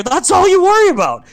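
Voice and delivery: very high, loud sneering taunt; mocking taunt. Sister taunting her brother, sneering. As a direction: needle him about his career.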